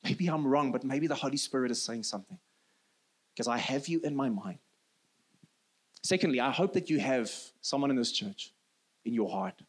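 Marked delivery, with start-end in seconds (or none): 0.0-4.6 soft, low, gentle plea to a friend; loving. Acted. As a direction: speak the hard truth tenderly, much softer and lower. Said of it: "but in a loving way".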